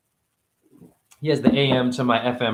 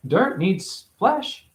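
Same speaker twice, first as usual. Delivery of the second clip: usual speaking voice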